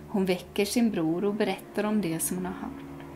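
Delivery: usual speaking voice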